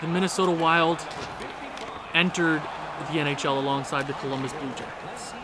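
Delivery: low, soft history-recap voice; hushed, wistful looking back; reflective documentary narration. Acted. Retells the franchise history.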